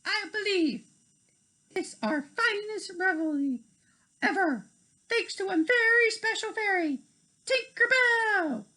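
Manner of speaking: high, proud regal praise; beaming queen. Acted. Queen Clarion praising Tinkerbell, high proud voice. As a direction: announce it with pride. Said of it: "Queen Clarion beamed".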